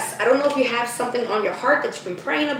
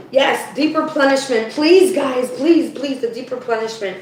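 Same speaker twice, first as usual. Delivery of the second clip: ordinary talk, nothing marked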